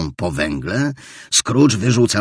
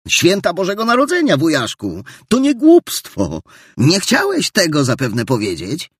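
eager nephew; 10 semitones higher, 5 dB louder than usual. Acted. Nephew protests to his uncle.

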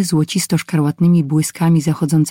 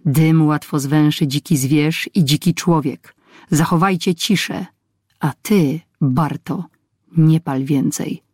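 ordinary delivery

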